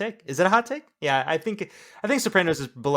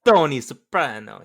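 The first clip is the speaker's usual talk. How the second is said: a light New Jersey mobster twang on "Tony Soprano", louder; Tony Soprano accent; 8 dB louder than usual. Retold or imitated. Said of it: "do you think that's how Tony Soprano talks?".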